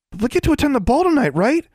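put-on very high, fast, eager kid voice; high-pitched young character, bubbling over; excited youngster. A narrator's reading. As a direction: burst out high and quick like an overexcited child. Awesome, a youngster, excited about ball.

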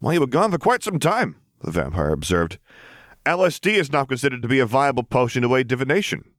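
easygoing henchman brushing off a nuisance; lightly voiced vampire. The vampire speaks.

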